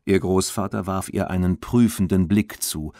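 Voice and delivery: usual speaking voice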